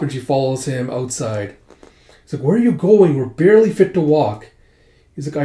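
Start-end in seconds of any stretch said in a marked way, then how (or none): 2.4-4.5 loud, raised-pitch protest; urgent remonstrating. Acted. Pernille follows Jonah outside, protesting loudly and urgently. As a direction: challenge them loudly as they walk off.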